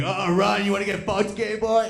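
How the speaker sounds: high, loud, hammed-up jeer; mock bully taunt. Bully chasing a running kid, hammed-up taunt.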